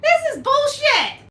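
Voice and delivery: usual speaking voice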